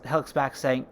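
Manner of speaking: ordinary delivery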